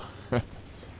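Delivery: short amused laugh; quiet laugh. Real, not acted. Laughing at a funny escape.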